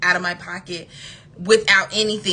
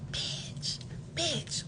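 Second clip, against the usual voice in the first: a breathy whispered "bitch" to the camera; whispered aside; 6 semitones higher, 15 dB quieter than usual. Real, not acted. Telling viewers about the date, whispering in relief. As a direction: drop to a whisper for the punchline.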